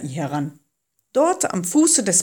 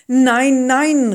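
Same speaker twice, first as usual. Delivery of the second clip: loud, high refusal; crying out; 5 semitones higher, 8 dB louder than usual. A narrator's reading. Moses refusing God's mission, crying out. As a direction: call out in urgent refusal. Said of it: "rief Mose".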